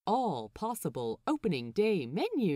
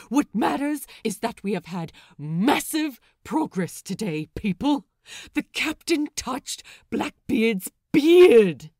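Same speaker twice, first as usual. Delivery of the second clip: loud announcement; 3 semitones higher, 11 dB louder than usual. Acted. Lucius revealing the gossip to the crew, loud. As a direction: announce it like huge news.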